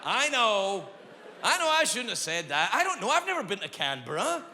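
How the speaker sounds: half-laughing, high defensive plea; high-pitched backtracking. Acted. Comic excusing a Canberra insult to the crowd, high-pitched backtracking.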